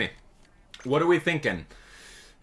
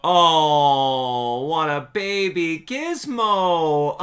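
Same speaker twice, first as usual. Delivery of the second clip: cooing at cat; 7 semitones higher, 6 dB louder, much slower than usual. Real, not acted. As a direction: melt over a cute kitten.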